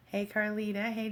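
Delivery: ordinary talk, nothing marked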